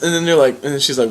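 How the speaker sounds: normal talking voice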